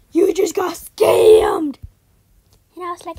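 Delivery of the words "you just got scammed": slow, harsh gleeful whisper; gloating whisper. Retold or imitated. The scammer gloats at the tricked angel. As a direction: hiss the gloat slowly. Girl gloats after scam.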